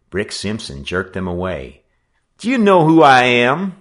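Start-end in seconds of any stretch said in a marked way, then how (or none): 2.4-3.8 loud, high, affronted shout; blustering gang-leader outburst; outraged demand. A narrator's reading. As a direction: pull rank furiously. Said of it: "he demanded".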